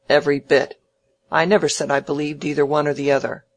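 loud opening, then quick retort; testy old man, brushing the challenge off; snappish Featherstone. Acted.

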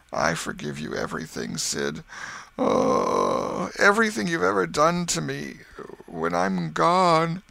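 milk the deathbed farewell; hammed-up martyr's moan; feigned dying. Tom plays a deathbed scene.

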